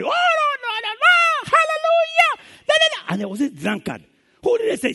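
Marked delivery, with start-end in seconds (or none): 0.0-3.1 high, loud, hammed-up praying woman; shrill-pitched loud hallelujah prayer. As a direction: wail it like an over-eager church lady. Pious woman praying loudly.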